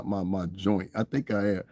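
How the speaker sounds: ordinary talk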